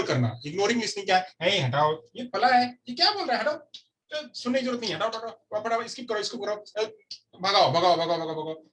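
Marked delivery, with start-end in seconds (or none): 1.4-6.8 much higher, scoffing voice; ignoring student pushing the lecture aside, high and scornful; mock dismissive brush-off. Acts someone ignoring talk. 7.4-8.6 shooing it away. Acted. Acts skipping the video.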